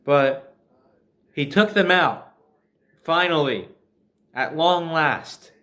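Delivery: usual speaking voice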